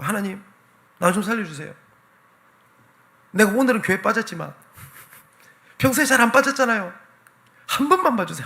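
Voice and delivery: high, loud begging prayer; desperate plea. Retold or imitated. Young man clinging to a branch, begging God to save him. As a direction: plead with God as if your life hangs on it.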